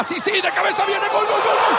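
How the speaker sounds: rising from fast call to high shouted gol; building goal call. Real, not acted. Header goes in, commentator erupts.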